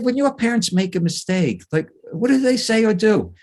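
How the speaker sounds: put-on high, innocent kid asking; innocent child voice. Shown as a demonstration. Speaker acting a boy asking his parents innocently. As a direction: ask it sweetly like a curious child. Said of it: "Just like this, very innocently".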